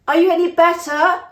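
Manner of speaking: high, loud call across a distance; family shouting up to the sick mother. Acted. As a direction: call it out to someone far off.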